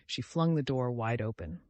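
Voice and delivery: usual speaking voice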